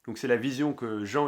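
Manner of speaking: normal talking voice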